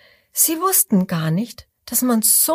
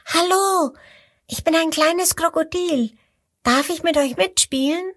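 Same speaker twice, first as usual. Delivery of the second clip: high, friendly little voice; 9 semitones higher than usual. Acted. Little crocodile greets the duck family, high friendly voice. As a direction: greet them kindly like a tiny crocodile. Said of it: "sagt es freundlich".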